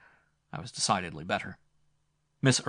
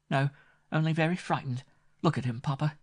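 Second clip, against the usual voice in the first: higher, gently mocking young woman; lightly teasing girl; 7 semitones higher, 4 dB louder than usual. A narrator's reading. Grace gently mocking Winmore to her father, playful voice. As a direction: make light of his fright.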